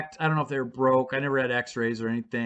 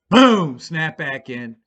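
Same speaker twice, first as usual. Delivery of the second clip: normal talking voice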